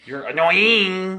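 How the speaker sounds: high, drawn-out taunting complaint; teasing whine. Pretend. To kid after a play punch, teasing whine. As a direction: stretch out the last word teasingly.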